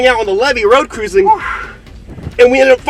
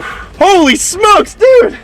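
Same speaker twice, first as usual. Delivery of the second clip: high, slow, drawn-out wonder; awed exclamation; 8 semitones higher, much slower than usual. Real, not acted. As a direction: marvel at it, then punch dude. Giant pythons together.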